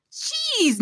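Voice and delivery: high yelped jeez; hollered jeez. A narrator's reading. Neva Jean recoiling from poisoned cake, high yelped jeez. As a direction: squeal it in disgust. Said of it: "Neva Jean hollered".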